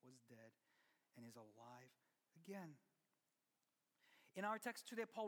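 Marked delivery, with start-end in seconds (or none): 0.0-2.8 soft, low reading of the verse; quiet scripture reading. Real, not acted. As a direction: read it almost hushed. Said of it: "verse twenty-four says".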